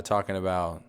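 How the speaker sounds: usual speaking voice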